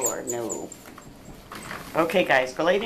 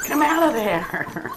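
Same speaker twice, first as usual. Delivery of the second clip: higher, slow sing-song coax; coaxing puppy; 11 semitones higher, 5 dB louder, slower than usual. Real, not acted. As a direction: lure the pup out sweetly.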